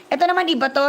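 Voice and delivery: ordinary delivery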